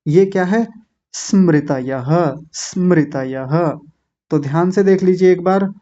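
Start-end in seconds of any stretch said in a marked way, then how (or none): 1.1-3.8 स्मृतयः drawn out slowly, starting on a pure s; slow स्मृतयः with the final visarga sounded as ha; careful model pronunciation. Shown as a demonstration. Teacher modelling a tricky word, slow careful स्मृतयः. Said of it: "यहाँ तो बहुत गलतियाँ होती हैं".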